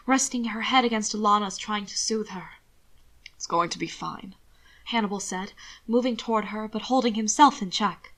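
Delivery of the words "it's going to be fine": reassuring. Acted. Hannibal reassures Alana.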